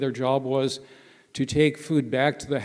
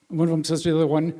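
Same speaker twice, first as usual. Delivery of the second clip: usual speaking voice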